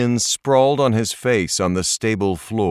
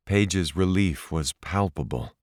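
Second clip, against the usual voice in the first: plain reading voice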